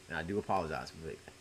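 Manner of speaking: normal talking voice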